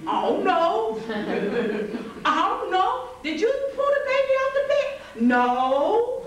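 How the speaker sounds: laughing mock innocence; guilty denial. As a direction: stammer out a giggling excuse. Guilty sibling denies, laughing.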